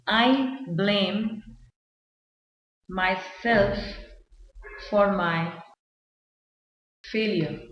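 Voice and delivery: slow, with long pauses between phrases; halting, spaced-out example sentence; word-by-word dictation. Shown as a demonstration. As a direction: dictate it piece by piece as if writing it out. Writing the example out.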